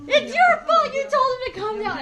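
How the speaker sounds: high, fast mock blame; mock accusing whine. Teasing siblings blaming dad, high mock whine.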